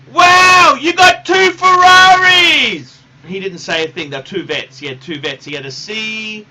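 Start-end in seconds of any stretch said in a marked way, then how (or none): 0.1-3.0 high, loud, drawn-out squeal of amazement; mock-gush like a starstruck visitor; mimicking the woman. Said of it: "she goes".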